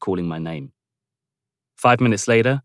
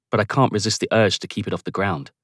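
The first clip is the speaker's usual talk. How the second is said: plain reading voice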